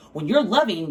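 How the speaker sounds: ordinary talk, nothing marked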